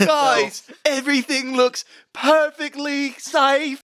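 high-pitched, drawn-out lookout report; calling from lookout. Acted. Lookout reporting to the crew from the crow's nest, high drawn-out call. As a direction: call it down high and slow, half laughing.

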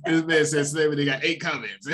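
laughing through the words; can barely get it out for laughing. Real, not acted. Laughing while retelling a quote.

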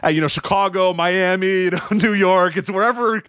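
tossing off a canned line like a pro wrestler; imitating Daniels. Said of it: "And he was just like".

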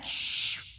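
imitated sent-mail swoosh; long voiceless shush like mail leaving; whoosh noise. Speaker cueing Tommy for the noise, imitated whoosh. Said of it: "Tommy, where's that?".

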